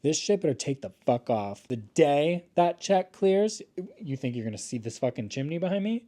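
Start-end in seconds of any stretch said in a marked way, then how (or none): 1.7-3.6 slow emphasis. Real, not acted.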